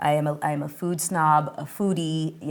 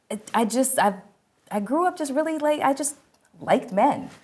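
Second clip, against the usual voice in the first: ordinary talk, nothing marked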